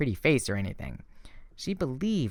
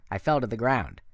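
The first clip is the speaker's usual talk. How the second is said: plain reading voice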